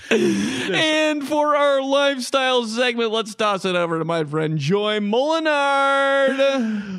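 high, bright sportscast read; news anchor voice. Pretend. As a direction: deliver it like a cheesy TV anchor. Anchor tosses to co-host.

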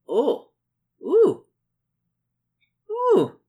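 rising series of loud, high oohs; excited oohs. Real, not acted.

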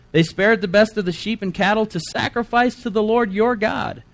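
high, put-on justifying voice; high-pitched, pious excuse; Saul making excuses. Reader voices Saul's excuse.

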